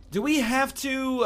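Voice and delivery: put-on high, slow childish whine; whiny kid. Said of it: "I said that like a whiny kid".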